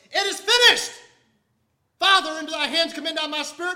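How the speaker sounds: loud, high triumphant shout of victory; victorious cry. Retold or imitated. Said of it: "said in a victorious voice".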